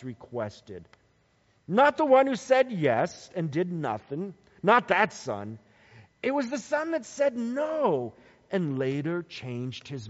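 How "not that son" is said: ordinary talk, nothing marked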